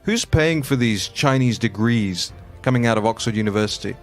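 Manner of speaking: ordinary talk, nothing marked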